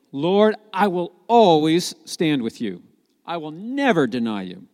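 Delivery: vowing disciple. Acted. Peter vowing to always stand with his Lord, emphatic. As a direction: pledge it firmly, leaning on the big words. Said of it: "He was the guy who said".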